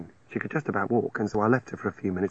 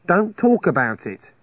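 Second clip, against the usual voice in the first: firm; 8 semitones higher, 6 dB louder, slower than usual. A narrator's reading. Narrator hushes her firmly.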